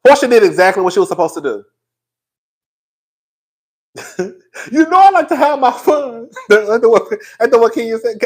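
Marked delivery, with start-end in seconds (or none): 4.7-6.3 [name]. Retold or imitated. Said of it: "Ain't that what [name] said?".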